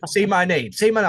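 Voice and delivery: playful, pushy demand; demanding lover. Acted. Acting out a celebrity's demand.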